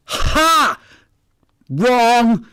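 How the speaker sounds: loud, high gotcha shout; triumphant shout. Retold or imitated. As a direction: crow it out in triumph. Client triumphantly contradicts the reader.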